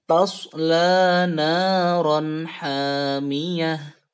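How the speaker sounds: very slow chanted Tasla naran; slow tajwid chant; Quran recitation. Shown as a demonstration. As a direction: draw it out as a sung verse. Teacher recites verse four.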